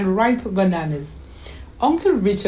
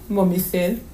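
ordinary delivery, nothing marked

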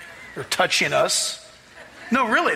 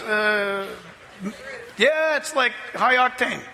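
normal talking voice

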